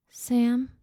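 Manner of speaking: put-on high, faint boy's voice. A narrator's reading. Injured boy giving his name to rescuer, faintly. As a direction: answer as a hurt, high-voiced child. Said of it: "the boy barely breathed".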